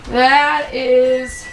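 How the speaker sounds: usual speaking voice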